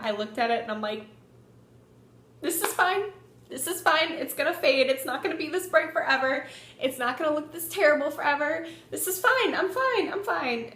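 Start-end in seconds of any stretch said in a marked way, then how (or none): 2.4-10.7 high-pitched, repeated self-reassurance; forced optimism. Retold or imitated. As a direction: convince yourself it's fine in a high voice. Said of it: "the kind of optimistic person that I am".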